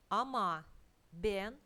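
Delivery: ordinary talk, nothing marked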